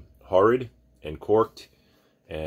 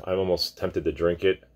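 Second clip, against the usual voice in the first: ordinary delivery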